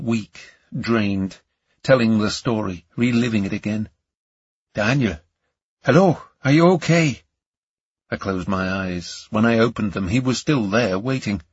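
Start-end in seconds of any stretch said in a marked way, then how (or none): 4.7-7.2 higher, louder call. A narrator's reading. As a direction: call him back to attention. Calling to dazed Daniel.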